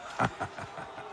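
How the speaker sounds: quiet mocking chuckle under the breath; a dismissive little laugh; soft breathy scoff-laugh. Lord mocks righteous samurai.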